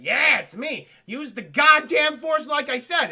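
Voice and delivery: loud, high exasperated retort; exasperated mentor. Acted. Ben answering Luke's doubt, comically exasperated. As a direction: drop the mystique, impatient and loud. Ben snaps back at Luke.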